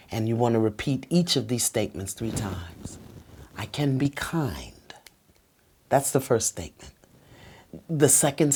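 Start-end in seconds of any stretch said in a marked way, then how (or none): 3.6-4.7 soft whisper, sincere; whispered I care. Shown as a demonstration. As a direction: breathe it gently to your partner. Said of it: "you wanna speak slow".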